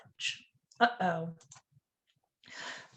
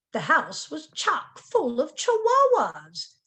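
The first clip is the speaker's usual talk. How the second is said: ordinary delivery, nothing marked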